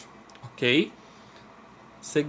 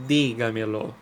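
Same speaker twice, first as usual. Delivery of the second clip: DÍGAMELO with a strong DÍ, then falling away; stress on first syllable; 3 semitones lower than usual. Shown as a demonstration. Teacher giving a second example word, dígamelo stressed on DÍ. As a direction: punch the DÍ of dígamelo. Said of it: "o di é a sílaba tônica".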